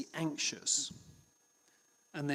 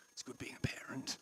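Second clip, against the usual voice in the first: whispered thought; 9 dB quieter than usual. Retold or imitated. Parent's relieved inner thought.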